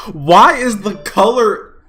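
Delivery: loud, slow, high outraged cry; slow loud why of disbelief; outraged wail. Real, not acted. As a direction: howl the question at the screen. Outraged at the color.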